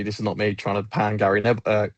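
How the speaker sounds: ordinary talk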